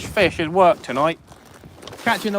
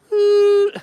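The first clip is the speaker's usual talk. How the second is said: high squeaky ooh; 4 dB louder than usual. Pretend.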